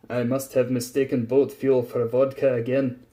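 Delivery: ordinary delivery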